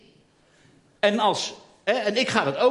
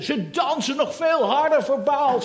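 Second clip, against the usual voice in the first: ordinary delivery, nothing marked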